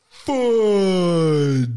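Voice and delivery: long, loud, drawn-out exclamation; high, slow-motion cry sliding down in pitch; Christmas Story fudge. Acted. Kid quoting the movie's slipped swear, drawn-out cry. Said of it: "I just pulled the Christmas Story".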